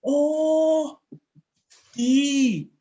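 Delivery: high, loud "Oh, tea" of sudden understanding; friend's realization. Retold or imitated. The Thai friend finally reading the word, high and loud. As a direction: squeal it like the penny just dropped. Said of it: "ketawa dia".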